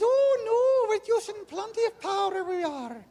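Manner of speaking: put-on high, lilting Irish old lady; Irish lady explaining she uses plenty of power; Irish dialect. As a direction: play the Irish cottage lady, high and sweet. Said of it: "pretending I can speak in, in a, with an Irish dialect".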